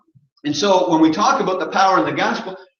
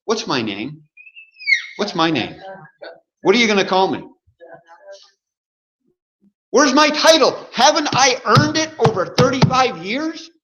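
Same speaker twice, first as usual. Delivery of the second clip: pompous church leader; 7 semitones higher than usual. Acted. Preacher mocking a leader who craves a title, pompous. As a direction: puff up and demand the title you think you've earned.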